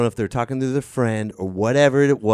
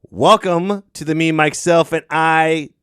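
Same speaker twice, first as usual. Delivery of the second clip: show intro announcer; 6 semitones higher, 5 dB louder than usual. Acted. Host opens the podcast.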